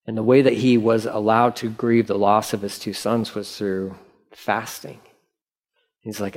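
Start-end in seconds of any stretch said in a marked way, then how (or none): none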